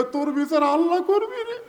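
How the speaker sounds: wailing retold in a high stretched voice; mock wailing. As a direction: imitate a tearful wail, pitched well up. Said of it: "কান্দার কয়".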